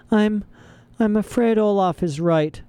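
high, faltering, upset judge; tearful. A narrator's reading. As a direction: admit it through tears. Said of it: "Justice Strauss said through her tears".

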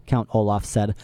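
usual speaking voice

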